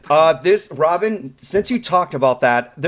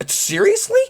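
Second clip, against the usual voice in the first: squeaky high disbelief; high-pitched mock incredulity; incredulous. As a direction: shoot way up in disbelief. Incredulous reaction in story.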